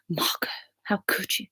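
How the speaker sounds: hushed, breathy mock dismay; playful stage whisper of reproach; mock horrified whisper. Mock horror at eating Easter bunny.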